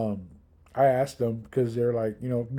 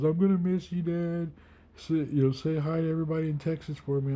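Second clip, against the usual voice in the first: put-on sleepy child voice; 5 semitones higher than usual. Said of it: "he goes".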